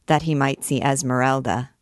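plain reading voice

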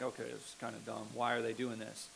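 low, soft, quick aside; understated grumble, dropped low and hurried; mild annoyance. Acted. Preacher mocking too mild a Jesus, low offhand grumble. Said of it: "Jesus is like".

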